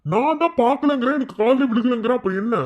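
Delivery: high, loud and slowed, spelling it out; exasperated insisting. Acted. Husband insisting to his wife he didn't hear, exasperated. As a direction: defend yourself in frustration.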